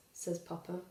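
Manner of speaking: ordinary delivery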